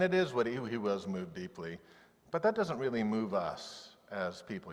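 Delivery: ordinary talk, nothing marked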